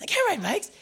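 refusing the little brother's offer, in a high pitch; high panicked protest. Retold or imitated. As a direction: squeak it out in alarm. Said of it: "and I said".

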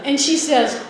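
normal talking voice

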